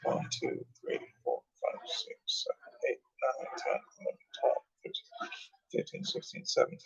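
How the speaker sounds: soft, breathy counting down the list; whispered count. Real, not acted. Counting the tracks on the tape, barely voiced. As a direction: whisper the numbers under your breath.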